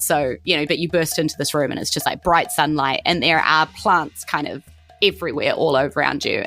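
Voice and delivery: usual speaking voice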